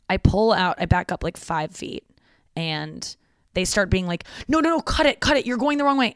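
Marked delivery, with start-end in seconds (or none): 4.5-6.1 high-pitched imitation of frantic onlookers; mock panicked cries to a driver; retold urgent warning. Friends calling warnings to the driver.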